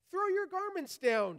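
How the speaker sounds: an annoyed donkey snapping at the crowd; a touch of proud irritation; cross. A narrator's reading. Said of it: "he said crossly".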